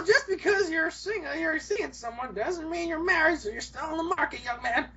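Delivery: put-on high laughing old-lady voice; flirty granny. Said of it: "She's like".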